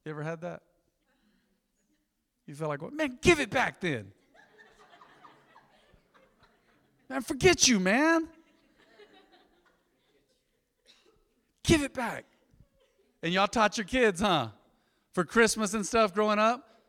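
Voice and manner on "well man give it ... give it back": loud, high, whiny mock complaint; mock greedy grumble. Preacher mocking his own stingy heart, loud, high and whiny. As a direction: ham up a sore loser wanting the gift back. Imagined stingy reaction to giving.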